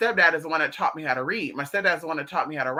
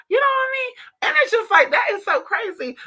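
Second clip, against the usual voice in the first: much higher and a bit louder; high exclamation; 4 dB louder than usual. Real, not acted. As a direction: squeal it out in disbelief.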